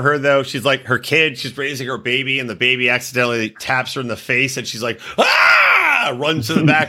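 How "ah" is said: sudden high shriek, hammed up; a fake, over-the-top yelp of pain; mock scream. Baby taps face, mock scream.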